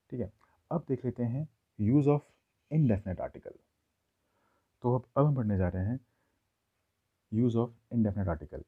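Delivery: usual speaking voice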